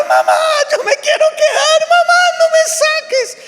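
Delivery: put-on high, loud crying little girl begging; child crying. Little girl crying to her mother at the play area, high wailing plea. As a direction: wail like a little girl who won't leave. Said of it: "empezó a llorar".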